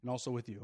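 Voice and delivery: low, soft response with a stress on "also"; modelling the reply for the congregation. Shown as a demonstration. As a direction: say "And also with you" gently, leaning on "also". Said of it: "next time you say it, say".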